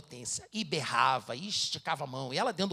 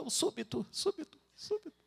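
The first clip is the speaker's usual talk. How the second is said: nearly crying; 12 semitones higher than usual. Retold or imitated. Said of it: "Quase chorando ali na frente dela".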